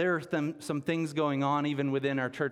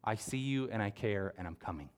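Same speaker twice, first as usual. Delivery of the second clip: softer and softer, a gentle promise; tender reassurance; 3 semitones lower, 4 dB quieter than usual. Pretend. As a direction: fade into a quiet, comforting vow. God speaking truth over you.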